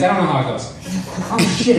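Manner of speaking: normal talking voice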